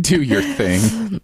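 slow, high drawn-out echo. Acted. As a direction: stretch it out playfully. Echoing the quote, drawn out.

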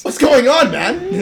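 high, hammed-up Scottish samurai; mock samurai voice. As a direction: squeak it out as a goofy samurai. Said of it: "blend of a Scottish and Japanese accent".

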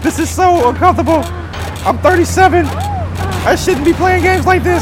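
high, whiny squealing complaint; high-pitched mortified whine, slowing down at the end; embarrassed squeal. Real, not acted. Embarrassed by game passengers.

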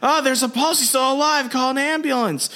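high-pitched, play-acted distress; Jackie. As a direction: call for help in a mock-frantic high voice. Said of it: "Jackie runs over and she's like".

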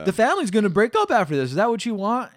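loud, high threat; menacing warning. Retold or imitated. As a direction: lean in and threaten hard. King threatening nephew.

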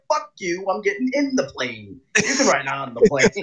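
high, mock-defiant retort; hammed-up airline comeback; mock defiance. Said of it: "I said".

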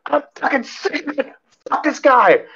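very high squealed imitation of rage; strangled screech, as retold, not full volume; mock meltdown. Angry lady ranting when security asks what's going on, mock meltdown. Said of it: "she's losing it".

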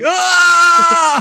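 high loud imitated scream; mock scream. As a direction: let out a hammed-up movie scream. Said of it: "When he's like".

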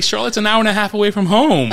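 high, loud comic protest; mock outrage. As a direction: protest in exasperated disbelief, half laughing. Retold protest at diverted flight.

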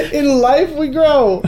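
bad acting. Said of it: "This is why I'm a terrible actor".

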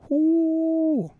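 high falsetto whoo of delight; excited whoop. Real, not acted. Hyped about the kangaroo boxing, high whoop. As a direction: let out a hype whoop.

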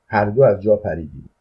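ordinary delivery, nothing marked

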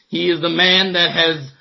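normal talking voice

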